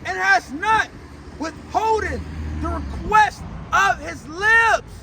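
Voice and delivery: slow, punched phrases with stretched endings; drawn-out pulpit proclamation; forceful psalm preaching. Acted. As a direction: hammer each phrase, pausing between.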